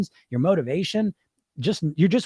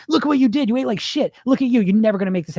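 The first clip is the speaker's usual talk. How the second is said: high-pitched scolding; acted-out nagging inner critic; critical inner voice; 6 semitones higher, faster than usual. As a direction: berate yourself in a raised, accusing voice. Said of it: "And so that voice starts".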